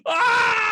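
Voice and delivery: high, loud mock scream; a shocked yelp at the kneecap. Imitating the shock at the kneecap, high and loud. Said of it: "You're like".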